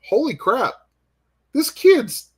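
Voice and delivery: high, halting burst of delighted disbelief; amazed excitement. Retold or imitated. Author marveling at a teenager's werewolf sketch, amazed excitement.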